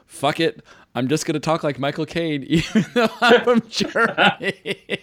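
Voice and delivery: playful impression breaking into high laughter; Michael Caine. Host teasing actor about his German role, laughing impression. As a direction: toss it off, then crack up. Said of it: "I like how Michael Caine just like".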